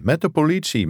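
high, emphatic "de" in "met de politie"; mild shout. A narrator's reading. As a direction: insist on it over the noise. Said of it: "schreeuwde hij".

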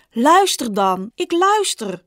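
acted sibling shouting match; shouting at each other. A narrator's reading. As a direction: build from insistent to high exasperation. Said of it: "tegen elkaar hadden geschreeuwd".

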